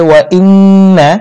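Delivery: "ইন্না" with a long nasal n; ghunnah on nun. Shown as a demonstration. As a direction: hum and hold the double ন in "ইন্না". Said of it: "এখানে গুন্না করতে হবে". ("ইন্না"-র নুনে টেনে গুন্না)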